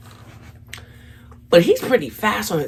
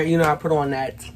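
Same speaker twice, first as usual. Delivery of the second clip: usual speaking voice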